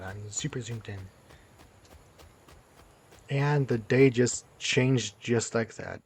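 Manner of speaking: ordinary talk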